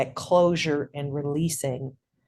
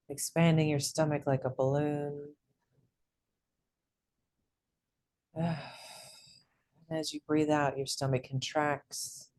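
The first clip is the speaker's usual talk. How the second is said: soft, unhurried meditation voice with long pauses; guided meditation; 6 dB quieter than usual. Real, not acted. Host leads group through breath and elements, soft guided meditation. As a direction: guide the group into calm, hushed and even.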